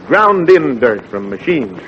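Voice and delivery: ordinary delivery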